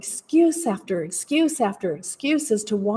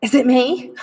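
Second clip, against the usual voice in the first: the second ask higher and louder, pleading; sister's plea; 3 semitones higher, 6 dB louder than usual. Retold or imitated. Sister asking the speaker if she is the problem, rising plea. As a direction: plead like the sister who wants an answer. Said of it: "She says".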